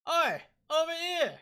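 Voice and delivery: high, loud mock call-out; mimicked shout. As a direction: holler it like the character, imitating. Echoing the game character's shout.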